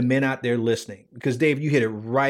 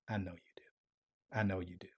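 very soft, hushed i know you do; gentle fatherly reply; 4 semitones lower, 12 dB quieter, faster than usual. Pretend. God answering his child, hushed and tender. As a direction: answer like a loving father, quietly.

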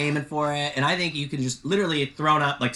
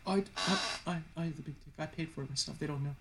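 much softer, a higher mock Walt; mock Walt, quiet; 3 semitones higher, 9 dB quieter, faster than usual. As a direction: hush it like a sulky boast. Mocking Walt's bragging, quoted.

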